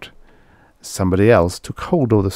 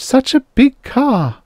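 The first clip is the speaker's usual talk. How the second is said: high, slow, breathless wonder in a much higher voice; wide-eyed wonder; 5 dB louder than usual. A narrator's reading. The mayor's wife marvelling at the huge car, slow high wonder.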